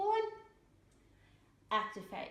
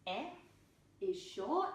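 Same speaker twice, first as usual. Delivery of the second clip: usual speaking voice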